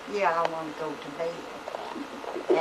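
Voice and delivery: put-on slow, soft, weak child's reply; little boy's answer. Scared four-year-old answers his mother, slow and soft. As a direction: answer slowly and softly like a scared little boy.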